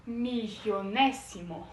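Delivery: milionésimo slow, with a clear s, mi-lio-NE-si-mo; Spanish pronunciation. Shown as a demonstration. Teacher contrasting Spanish with Portuguese, Spanish pronunciation. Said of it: "em espanhol fica".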